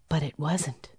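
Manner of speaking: plain reading voice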